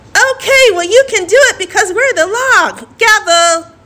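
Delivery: high, laughing hammy judge; mock judge voice. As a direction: rule on it like a silly judge.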